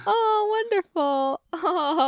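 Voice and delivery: high-pitched fake cooing; insincere, politely gushing; fake enthusiasm. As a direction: pretend to be charmed. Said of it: "it's very fake".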